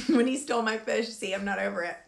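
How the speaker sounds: ordinary talk, nothing marked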